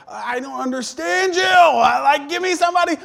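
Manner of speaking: fed-up yelling at a phone agent; frustrated shout. Retold or imitated. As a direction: blow up at the customer service line. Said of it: "you're like".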